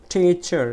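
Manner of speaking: "Teacher" with a loud, long টি; stress on টি. Shown as a demonstration. Teacher showing where to push, loud stress on টি. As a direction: hit the first syllable of "teacher" hard. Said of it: "টি বলতে গেলে আমাকে একটু জোরে".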